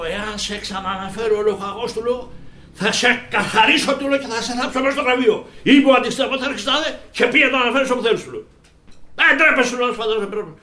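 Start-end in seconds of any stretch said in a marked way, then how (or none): 0.0-8.2 high, loud furious threat to the soldier; angry shout. Retold or imitated. Officer shouting threats at a defiant soldier, furious. 9.2-10.5 high loud scolding; angry shout. Retold or imitated. Officer yelling at the soldier, angry scolding.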